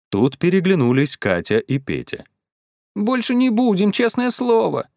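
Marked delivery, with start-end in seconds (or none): 2.9-4.9 put-on high-pitched children's voice; children promising. A narrator's reading. Катя and Петя promise their parents, earnest childlike plea.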